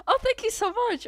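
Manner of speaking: light up at a sweet compliment; delighted thanks. Real, not acted. Streamer thanking a viewer for the kind message, delighted and high.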